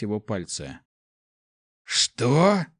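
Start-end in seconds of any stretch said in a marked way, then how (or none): 1.9-2.7 choked, rising question; gasping. A narrator's reading. Wounded prince finding blood, choked gasp. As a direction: gasp it out in mild shock. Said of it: "задохнулся он".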